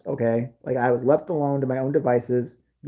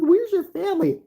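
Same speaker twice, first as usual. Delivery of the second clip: passerby asking; 3 dB louder than usual. Retold or imitated. Passersby ask the kid.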